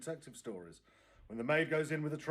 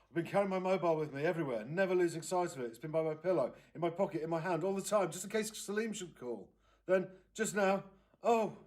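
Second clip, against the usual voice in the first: upset aunt, higher and louder, rushing; distraught aunt; 6 semitones higher, 6 dB louder than usual. Acted. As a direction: pour it out, on the edge of tears. Aunt Gloria distraught over phone.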